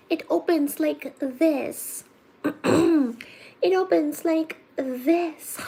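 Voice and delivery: ordinary delivery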